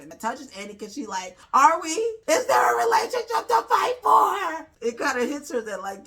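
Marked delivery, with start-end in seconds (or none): 1.5-4.7 high, loud sobbing shout; crying plea. Retold or imitated. Annie crying to Swanky, high and sobbing. Annie cries while quoted.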